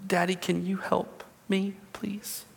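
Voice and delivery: put-on whispered little-girl plea, lip trembling; trembly child whisper. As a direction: beg softly like a pouting child. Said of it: "it trembles".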